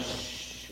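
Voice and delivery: unvoiced shush. Shown as a demonstration. Showing kids the quiet part, a soft shush. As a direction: hush them softly. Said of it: "times where it goes".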